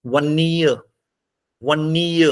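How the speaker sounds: वन्नीय with the second syllable नीय stressed and held; stress on last syllable. Shown as a demonstration. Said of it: "stress लगाई".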